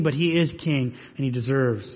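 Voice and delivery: usual speaking voice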